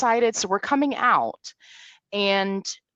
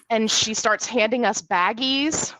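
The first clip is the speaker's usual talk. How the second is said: ordinary talk, nothing marked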